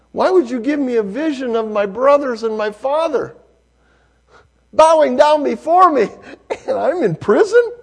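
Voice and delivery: high, loud, pleading cry to God; anguished prayer. Acted. Joseph's anguished prayer in prison.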